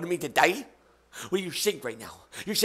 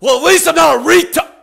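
loud jab; 9 semitones higher, 10 dB louder than usual. Real, not acted. Sudden loud jab at caller.

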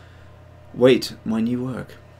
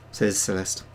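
ordinary talk, nothing marked

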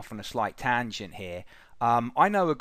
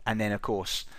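usual speaking voice

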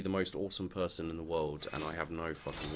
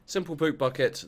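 a mock-triumphant loud proclamation; loud high exclamation; 9 semitones higher, 8 dB louder than usual. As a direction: shout Simple poop bucket high and loud. Repeats item name loudly.

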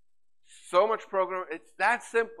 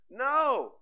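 high emphatic no; 9 semitones higher, 7 dB louder than usual. Real, not acted. Answering own question.

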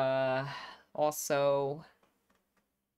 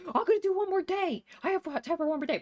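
eager; 13 semitones higher, 5 dB louder, faster than usual. Retold or imitated. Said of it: "and then I said".

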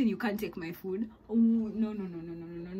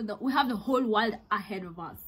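normal talking voice

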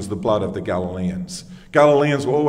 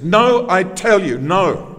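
NO drawn out high and loud, slow; an emphatic, preacherly "NO, I tell you, NO"; slow, forceful denial hitting each NO; 5 semitones higher, 4 dB louder, slower than usual. Retold or imitated. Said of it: "He says no emphatically".

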